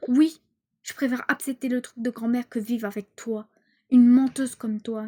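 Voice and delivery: loud, defiant yell; angry shout. Acted. Daughter yells at her mother that she is a liar, furious.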